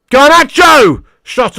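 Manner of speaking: real high, drawn-out yell of a player's name; excited shout. Real, not acted. Fan watching a live shot on goal, shouting excitedly.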